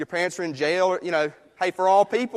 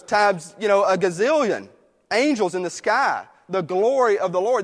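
preaching, building louder with each line; full preaching; 4 dB louder than usual. Acted. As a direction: lift the church up, pressing harder line by line. Rising preaching run.

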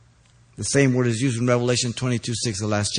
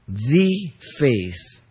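"The" stressed and set apart as "thee". Shown as a demonstration. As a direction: hit the article "The" before a pause. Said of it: "by the article that is found".

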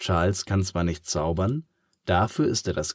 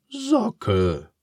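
prompting pig; 8 semitones higher, 3 dB louder than usual. Acted. Charles prompts the word.

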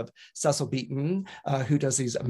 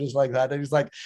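ordinary talk

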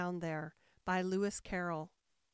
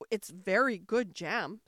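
louder, slow insistence; insistent queen; 7 dB louder than usual. A narrator's reading. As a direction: press the point, slowly.

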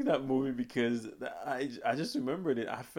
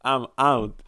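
fed up; 9 dB louder, slower than usual. Retold or imitated. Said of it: "I'm like".

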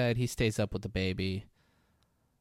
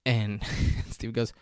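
usual speaking voice

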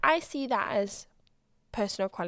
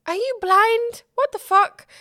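incredulous; 10 semitones higher, 9 dB louder than usual. Retold or imitated. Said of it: "I was just like".

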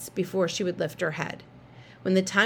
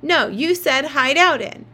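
yelling; 6 semitones higher, 6 dB louder than usual. Acted. Siblings yelling at each other.

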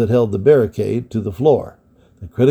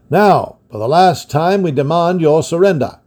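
calling out; 6 semitones higher, 3 dB louder than usual. A narrator's reading. Enemy captain calling out demand.

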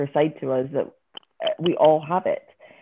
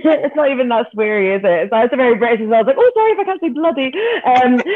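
higher voice, bubbling with laughter; giggling; 8 semitones higher, 5 dB louder, faster than usual. Real, not acted. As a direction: giggle helplessly through the explanation. Giggling over a British swear word.

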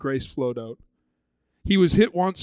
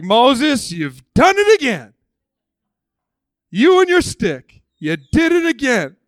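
cheering crowd; 8 semitones higher, 10 dB louder, slower than usual. Retold or imitated. People cheering Moses.

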